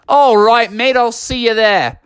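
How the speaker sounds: high Australian character voice; broad mock-Australian farewell; Australian accent. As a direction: play a kangaroo with an Australian accent. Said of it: "do the Australian accent for Kevin".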